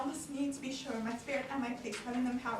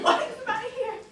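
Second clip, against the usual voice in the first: laughing shout; 10 semitones higher, 8 dB louder than usual. Acted. Woken father shouts down, laughing.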